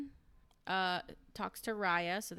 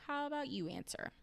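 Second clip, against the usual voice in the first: higher, softer, quicker retort; imitated teasing challenge; 7 semitones higher, 4 dB quieter, faster than usual. Group repeating the question to Lisa, lightly mocking challenge.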